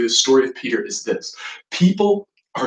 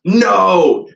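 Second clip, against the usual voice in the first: loud, higher acted "No"; insistent protest; 5 semitones higher, 8 dB louder than usual. Peter refusing Jesus' prediction of his denial, loudly insistent. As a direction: burst out in firm protest. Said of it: "Peter insisted".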